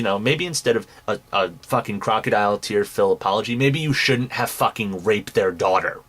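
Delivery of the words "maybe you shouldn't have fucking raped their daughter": ordinary talk, nothing marked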